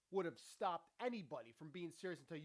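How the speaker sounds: usual speaking voice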